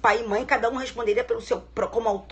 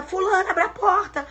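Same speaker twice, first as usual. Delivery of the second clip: high, loud repeated yell for the maid; shouting a name; 6 semitones higher, 6 dB louder than usual. Retold or imitated. Mother yelling for the maid to open the door, loud. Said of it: "começa a gritar pelo nome da empregada".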